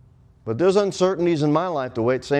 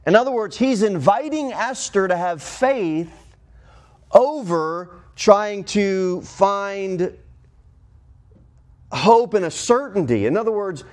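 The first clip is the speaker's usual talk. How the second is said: higher, insistent preacher's plea; urgent preaching; 6 semitones higher than usual. Acted. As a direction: press the congregation to have faith. Preacher urging faith, raised pitch.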